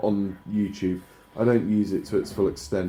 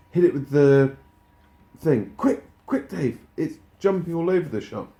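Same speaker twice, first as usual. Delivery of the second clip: excited urging; 6 semitones higher, 3 dB louder than usual. Real, not acted. Urgent game chase call.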